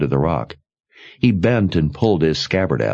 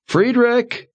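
calling out. A narrator's reading. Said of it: "he called toward the wagon".

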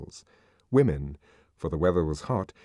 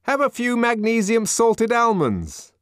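high, raised offering voice; placating onlooker; 14 semitones higher, 5 dB louder than usual. A narrator's reading. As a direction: call it out to appease an angry man. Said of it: "with a rather nervous smile of propitiation".

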